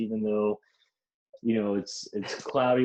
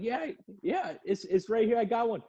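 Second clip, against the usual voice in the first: much higher, eager imitation of himself; 11 semitones higher than usual. Narrator answering the lady from the truck bed, high eager mock voice. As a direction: squeak it back obediently. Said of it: "I was like".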